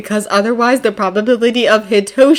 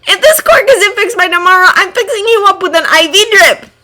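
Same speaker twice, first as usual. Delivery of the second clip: high cheerful voice with a smile; 11 semitones higher, 4 dB louder than usual. A narrator's reading. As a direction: say it smiling, teasing warmly. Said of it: "There's a smile in his voice".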